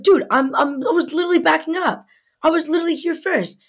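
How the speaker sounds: high, fast indignant insistence; re-enacted argument, rushing; retold heated protest. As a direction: plead your case, exasperated and high. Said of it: "just snapped".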